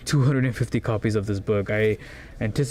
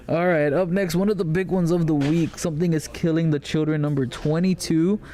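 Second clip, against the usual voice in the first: higher-pitched show-host announcement; upbeat announcer; 5 semitones higher than usual. Acted. Host introducing the week's big comic to viewers, upbeat announcing.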